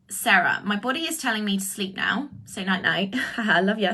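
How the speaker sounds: usual speaking voice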